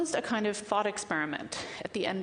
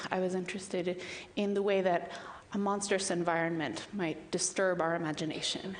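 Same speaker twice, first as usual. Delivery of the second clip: usual speaking voice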